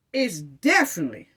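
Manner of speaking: normal talking voice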